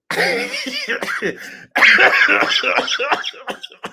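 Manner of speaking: long high cackle; loud cackle in disbelief; shrieking laugh. Real, not acted. Reaction to tweet.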